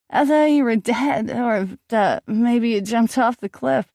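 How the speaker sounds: tipsy, words running together; slurred drunk rambling; drunk slurring. A narrator's reading.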